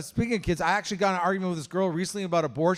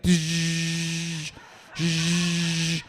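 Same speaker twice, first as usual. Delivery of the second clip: buzzing noise. Acted. Imitating dildo as blender.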